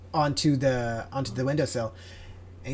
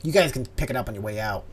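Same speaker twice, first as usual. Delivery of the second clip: ordinary talk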